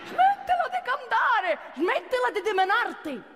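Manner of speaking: high, slow shrill mock boss voice; imitating the boss. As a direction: screech it like an exasperated boss. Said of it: "ha minacciato di licenziarmi".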